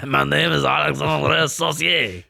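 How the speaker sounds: slow, hammed-up French accent. French kitchen worker introducing himself, in a French accent. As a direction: introduce yourself as a cartoon Frenchman. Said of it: "A French guy in Scotland".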